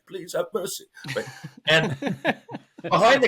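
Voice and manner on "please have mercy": small, higher pleading voice; higher, softer mock plea; mock begging. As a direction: softly imitate someone begging for life. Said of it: "I gotta beg for my life".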